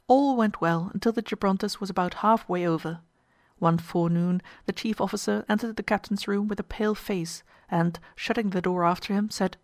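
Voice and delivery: plain reading voice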